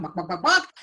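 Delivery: chicken clucking. Acted. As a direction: play the chicken for the kids.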